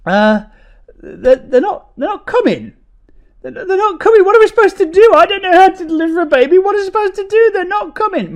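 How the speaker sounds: panicking husband telling wife help isn't coming; mock panic. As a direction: shriek helplessly at the wife. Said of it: "I'm absolutely mortified".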